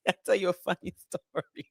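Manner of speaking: words breaking up into giggles; laughing. Real, not acted. About to tell viewers a funny story, laughing.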